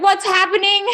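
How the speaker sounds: high, loud mock panic; panic mode. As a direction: burst in with an imitated frantic cry. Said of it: "panic mode".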